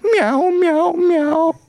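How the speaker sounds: high wailing meows; crying cat. Acted. Cat joining Martina's crying, high mock-wailing meows. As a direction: draw out each meow like a sad cat. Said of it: "And the cat said".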